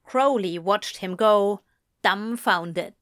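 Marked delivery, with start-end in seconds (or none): none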